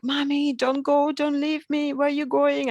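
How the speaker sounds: mock child wail; imitating crying child. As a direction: plead like a small son not wanting mum to leave. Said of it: "like, crying".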